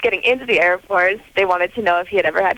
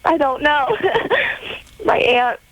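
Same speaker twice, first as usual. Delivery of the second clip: real tearful, halting and higher-pitched; crying; 4 semitones higher than usual. Real, not acted. Caller tells hosts about her aunt's similar experience, crying. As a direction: falter through tears while explaining. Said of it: "I am crying".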